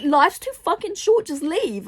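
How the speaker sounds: high-pitched exasperated outburst. Real, not acted. Reader urging a heroine to leave, exasperated.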